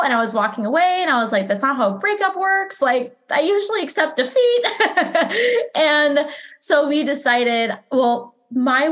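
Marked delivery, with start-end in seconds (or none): 1.5-4.7 high, amused disbelief; incredulous. Retold or imitated. Retelling her partner refusing to accept the breakup, incredulous. 4.7-5.7 bright high burst of laughter. Real, not acted. Laughing at her own breakup story, high burst of laughter.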